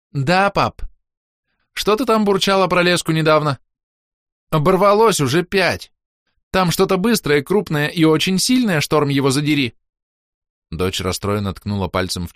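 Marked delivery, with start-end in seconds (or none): 0.1-9.7 put-on high young girl complaining about lines; teen girl voice. A narrator's reading. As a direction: voice the upset teenage daughter, pitched high. Daughter Roska's voice.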